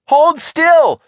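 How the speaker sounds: high, loud command; urgent cry. A narrator's reading. Margot grabs Shivers by the shirt, crying out. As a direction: cry it out while grabbing him. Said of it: "Margot cried".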